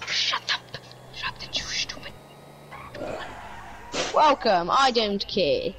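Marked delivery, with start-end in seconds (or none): 0.1-2.1 whispering. Real, not acted. Whispered insult to friend.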